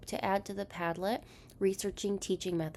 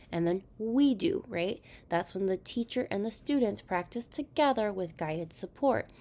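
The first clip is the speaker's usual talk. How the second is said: ordinary talk, nothing marked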